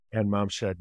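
ordinary delivery

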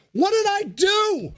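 high, slow outraged question; high indignant protest. Acted. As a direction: demand to know your crime. Sage protests.

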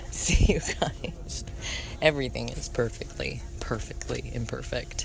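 airy, whispered sign-off; whispering. Real, not acted. Host on a train saying goodbye to listeners, whispering. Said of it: "I'm whispering right now".

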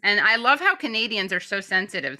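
ordinary talk

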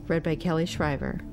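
plain reading voice

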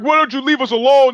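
high, loud, whiny plea; mock plaintive whine. Irish band's letter to him, mocked as a high plaintive whine. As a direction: whine it like a wounded victim. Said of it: "this kind of plaintive".

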